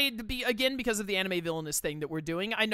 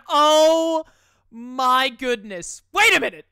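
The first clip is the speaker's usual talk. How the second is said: shocked shout; 10 semitones higher, 15 dB louder, much slower than usual. Real, not acted. Shocked by reveal.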